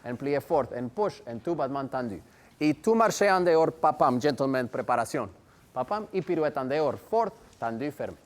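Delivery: counting the steps in rhythm; rhythmic counting. Acted. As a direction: call out the ballet counts. Teacher calls the combination.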